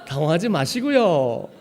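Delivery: loud mock Joseonjok accent; Korean-Chinese accent. Preacher parodying a caller telling customers not to panic, Joseonjok accent. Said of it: "자꾸 조선족이래요".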